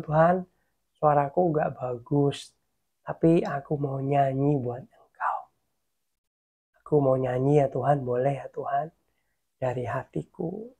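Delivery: low, hushed, humble request; soft intimate prayer. Retold or imitated. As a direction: ask God softly, sinking in volume. Retells intimate prayer before singing.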